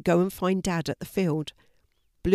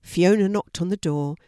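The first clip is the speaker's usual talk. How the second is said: ordinary delivery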